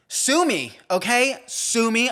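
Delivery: high, loud, defensive outburst; mock defiance. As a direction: throw it defiantly at the critics. Defiant reply to critics over mistake.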